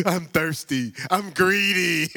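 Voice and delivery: hammed-up delight, drawn out; mock flattered listener. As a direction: play the vain one who loves hearing it. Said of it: "you like".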